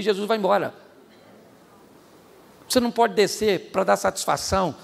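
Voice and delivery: usual speaking voice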